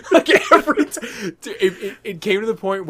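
can barely get it out for laughing. Real, not acted. Recalling the repeated Jeff Bridges joke, laughing.

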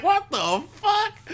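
high, drawn-out and slow; retold stunned reaction; shocked exclamation. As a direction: stretch out the disbelief. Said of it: "I was like".

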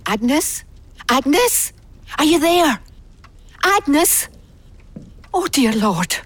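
searching the house for Agnes, calling out anxiously. Acted. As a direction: call into the dark house, worried.

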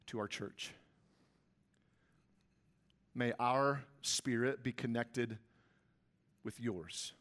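hushed, low prayer with long pauses; earnest prayer. Real, not acted. Prays aloud before hard words.

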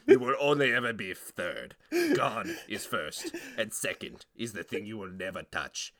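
solemn oddball book collector; mock-grave comic character; stupid funny character. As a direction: lay down rules in a silly put-on voice. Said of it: "it's a stupid fucking funny character".

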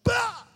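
explosive high "bah" as she slaps it on; imitated loud, high burst; host mother's yell. Host mother slapping on vapor rub, hammed-up yelp. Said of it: "she's like".

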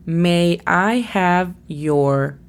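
very slow, word-by-word model reading; slow dictation. Shown as a demonstration. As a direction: dictate it slowly for a language learner. Teacher reads exercise sentence to learner. (dictado muy lento, palabra por palabra)

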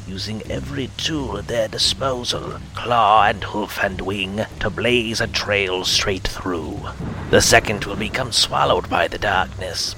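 trance-like whispered oracle; harsh whisper. Acted. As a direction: chant the vision in a harsh whisper. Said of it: "spoke in a harsh whisper".

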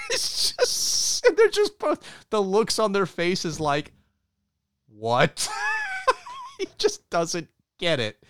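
high laughter breaking through the words; cracking up. Real, not acted. Host recounting the hug scene, breaking into laughter.

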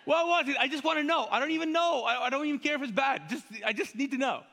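begging for any answer at all; frantic hammed-up pleading, a laugh breaking in; desperate to know. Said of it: "You're like".

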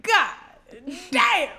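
high, loud astonished outburst; stunned loud exclamation. Retold or imitated. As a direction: blurt it out amazed. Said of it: "Feyre's like".

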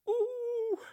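very high, loud scared cry; frightened yelp. A narrator's reading. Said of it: "råb snarere lød som".

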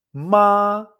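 MA en primer tono, alto y sostenido; mantén MA arriba, plano y fuerte. Shown as a demonstration. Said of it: "había cuatro tonos y un tono neutro". («ma» en tono alto, plano y alargado)

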